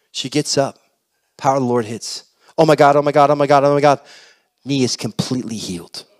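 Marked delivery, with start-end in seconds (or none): 2.6-4.0 loud, rattled-off exclamations; freaking out. Acted. Lisa finds her knee completely healed, freaking out. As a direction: repeat it loudly without a break, astonished. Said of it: "Freaks out".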